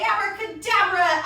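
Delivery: playful magician's abracadabra; magic word. Pretend. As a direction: announce the spell like a stage magician. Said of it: "And we say".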